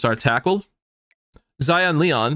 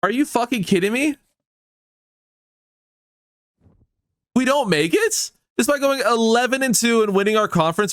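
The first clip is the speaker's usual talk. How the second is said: high, incredulous yelling at the screen; real disbelief, voice way up and ranting; furious outburst; 7 semitones higher than usual. Real, not acted. Gamer snubbed by the playoff, yelling in disbelief. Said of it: "I am actually fucking annoyed".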